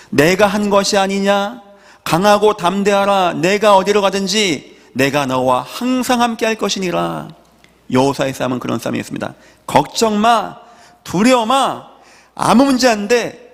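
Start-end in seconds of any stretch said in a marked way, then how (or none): 0.1-7.3 high, sustained declaration of God's charge; commanding scripture quotation. Acted. Preacher voicing God's charge to Joshua, commanding. 9.7-13.5 high-pitched, insistent encouragement in short bursts; emphatic reassurance. Acted. Preacher voicing God telling believers not to fear, emphatically reassuring.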